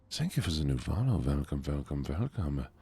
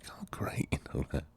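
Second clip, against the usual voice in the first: whisper; 4 dB quieter than usual. Acted. Whispered aside after thanking chat.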